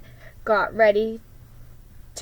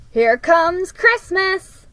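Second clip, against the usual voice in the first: high, loud and slow announcing; excited; 10 semitones higher, 8 dB louder, slower than usual. Acted. Girl announcing the ornaments to her brother, high excited call. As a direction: sing it out with glee.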